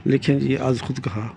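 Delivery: normal talking voice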